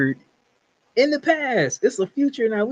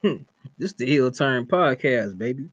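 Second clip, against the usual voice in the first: imitating co-host's intro; 8 semitones lower than usual. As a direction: mimic his laid-back intro, dragged out low. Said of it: "yours was more like".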